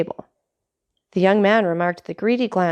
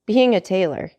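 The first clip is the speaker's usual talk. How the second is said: usual speaking voice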